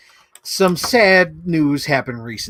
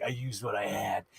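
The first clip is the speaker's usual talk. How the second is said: lightly voiced tough heroine, lower and much softer; a hint of a stoic superhero, low and soft; Power Girl's deadpan; 5 semitones lower, 10 dB quieter than usual. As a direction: drop into a cool, unbothered hero line. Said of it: "And Power Girl would be like".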